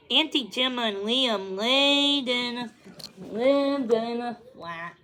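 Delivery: usual speaking voice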